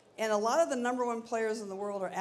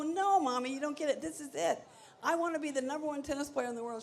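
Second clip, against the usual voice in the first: put-on insistent kid; 7 semitones higher than usual. Child insisting to mom.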